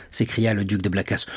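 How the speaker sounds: usual speaking voice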